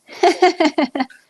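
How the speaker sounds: loud, high laugh; real peal of laughter. Real, not acted. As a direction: burst out in a high cackle. Laughs about fight with dog.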